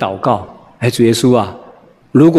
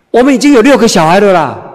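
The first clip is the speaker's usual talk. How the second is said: emphatic wife; 7 semitones higher, 5 dB louder than usual. Retold or imitated. Wife boasting six kids.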